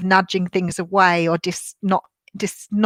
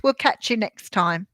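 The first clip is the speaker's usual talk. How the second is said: ordinary delivery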